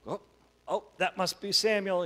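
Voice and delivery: put-on high, loud, eager boy; young boy Eli. Boy woken by the voice guessing who called, high loud excited voice. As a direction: blurt it out like an excited child. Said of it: "And Eli goes".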